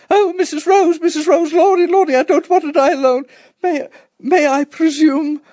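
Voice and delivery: very high, loud, panicked landlady; hysterical twittering. A narrator's reading. Landlady bursting in during an air raid, hysterically twittering. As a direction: twitter in shrieky, rushing hysteria.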